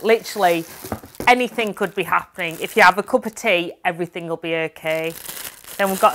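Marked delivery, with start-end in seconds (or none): none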